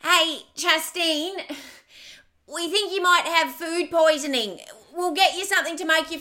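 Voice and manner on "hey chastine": ordinary delivery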